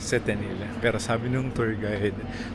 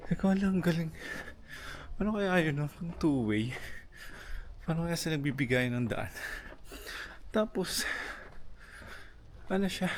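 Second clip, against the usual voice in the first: winded from climbing, higher pitched; out of breath; 6 semitones higher, 5 dB quieter than usual. Real, not acted. Solo traveler wondering at the uphill houses, out of breath. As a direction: puff through it between uphill steps. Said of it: "hinihingal ako. Uphill kasi siya".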